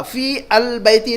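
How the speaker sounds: "फी अल बैती" with al said apart; "फी अल बैती" with the unwanted article sounded in full; form to avoid. Shown as a demonstration. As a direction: say "फी अल बैती" as fii, al, bayti. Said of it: "नहीं आएगा".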